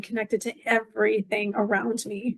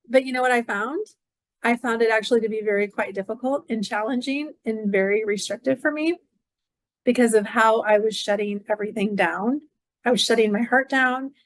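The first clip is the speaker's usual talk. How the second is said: usual speaking voice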